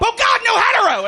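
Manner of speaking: high-pitched hammed-up cry of alarm; panicked shout. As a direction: cry out as if horrified by your own words. Acted panic while driving.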